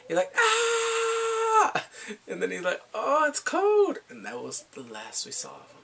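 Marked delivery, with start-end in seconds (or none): 0.4-1.8 high, loud sustained "ah" cry; mock wail. Imitating the army recruit at the barber, a loud mock wail. 2.9-4.0 high, slow, loud whine; mock whining. Imitating the freshly shaved recruit, a high drawn-out whine.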